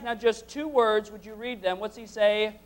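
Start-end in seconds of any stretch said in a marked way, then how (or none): none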